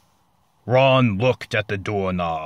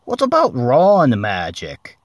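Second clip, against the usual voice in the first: put-on a touch higher, louder boy voice; hint of an eager Ron; mildly voiced hopeful boy; lightly voiced Ron; 4 semitones higher, 7 dB louder than usual. A narrator's reading. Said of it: "offered Ron".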